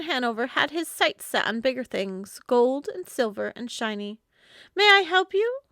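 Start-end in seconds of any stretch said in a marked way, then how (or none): 4.7-5.6 put-on higher, louder young lady; a sharp, bright question from Miss Ava; young woman's voice. A narrator's reading. Ava startling the man following her, higher and louder.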